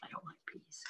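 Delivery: whispered complaint about peas; hushed sulky whisper; whispered grumble. Shown as a demonstration. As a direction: mutter it under breath.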